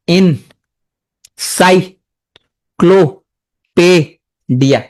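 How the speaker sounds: "Encyclopedia" very slowly, long pause between syllables; each syllable of "encyclopedia" standing alone; broken into syllables. Shown as a demonstration. Teacher breaking the word up for students, syllable by syllable. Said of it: "যদি ভাঙো".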